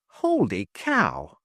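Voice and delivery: slow, drawn-out amazement; amazed. Acted. Trip realizes the answer, slowly amazed. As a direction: gasp it out in wonder.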